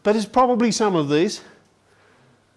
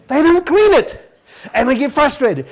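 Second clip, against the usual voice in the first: exasperated; 8 semitones higher, 6 dB louder than usual. Real, not acted. Father venting about kids never cleaning. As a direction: throw up your hands over it.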